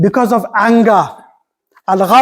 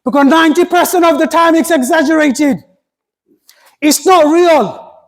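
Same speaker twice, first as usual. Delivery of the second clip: emphatic preaching; 9 semitones higher than usual. Acted. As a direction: lean hard into each word.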